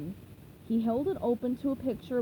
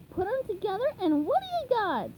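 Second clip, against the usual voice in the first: much higher hopeful question; eager coaching sponge; 9 semitones higher than usual. Acted. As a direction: ask it hopefully. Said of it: "SpongeBob asked hopefully".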